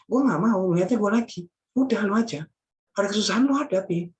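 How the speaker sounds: full-force preaching. Acted. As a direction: press the congregation hard.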